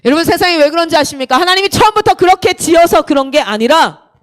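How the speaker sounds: urgent, projected preacher imitation; loud acted pulpit oratory; pastor preaching. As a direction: drive the point home to the congregation. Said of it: "목사님 얘기하시는 거예요".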